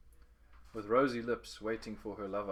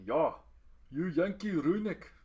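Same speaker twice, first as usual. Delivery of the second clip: loud, higher, slow jeering yell; shouted taunt; 8 semitones higher, 8 dB louder, slower than usual. A narrator's reading. College student shouting a taunt at the boy, loud and jeering. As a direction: bellow the insult across the yard. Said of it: "shouted at me".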